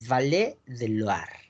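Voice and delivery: Valle del Loire repeated slowly as "valé de luar"; French Loire as "lwar"; French pronunciation. Shown as a demonstration. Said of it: "Valle del Loira, Valle del Loire".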